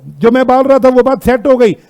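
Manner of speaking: usual speaking voice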